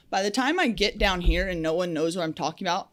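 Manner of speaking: usual speaking voice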